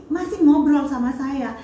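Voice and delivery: ordinary talk, nothing marked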